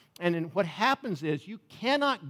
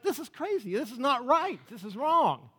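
indignant protest; 6 semitones higher than usual. Acted. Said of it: "that's what he's praying".

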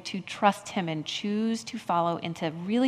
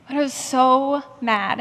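ordinary talk

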